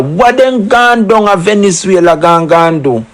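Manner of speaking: usual speaking voice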